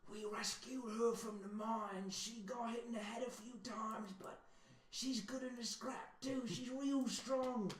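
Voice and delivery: a lizard murmuring a secret aside; quiet lizard voice. Acted. Ratley pulling the party aside, high and hushed. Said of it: "Ratley pulls you aside and says".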